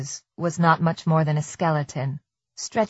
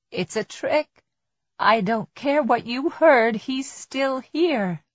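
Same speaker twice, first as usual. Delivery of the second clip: high thought voice, stretching out slowly; insistent inner voice; 7 semitones higher, slower than usual. A narrator's reading. Tess arguing with herself in hiding, high insistent inner voice. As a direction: argue with yourself, pitched up, drawing out the end. Tess's fearful inner argument.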